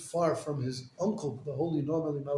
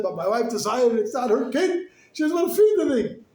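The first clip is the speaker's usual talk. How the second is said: high, loud, rising distress; a father pouring out his trouble, rising to a loud high pitch; distraught plea; 7 semitones higher, 8 dB louder than usual. Retold or imitated. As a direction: beg the rebbe in rising panic. Distraught father pleading to rebbe.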